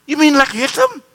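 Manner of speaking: incredulous question. Retold or imitated. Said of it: "guest asking back".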